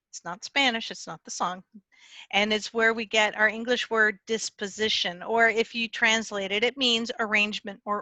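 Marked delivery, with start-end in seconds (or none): none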